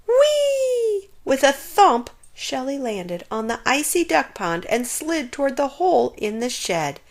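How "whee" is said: high, loud joyful cry; thrilled whee. A narrator's reading. Shelley sliding downhill, delighted squeal. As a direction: let it fly as you slide.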